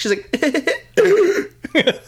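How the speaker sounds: high, put-on girlish giggle; imitated giggle. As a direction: mimic a cute contrived giggle. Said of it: "giggle at the end".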